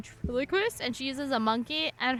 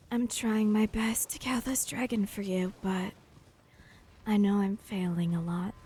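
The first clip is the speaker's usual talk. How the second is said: mommy voice; 3 semitones lower than usual. Shown as a demonstration. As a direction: murmur it like a soothing mommy streamer. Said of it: "super mommy voice".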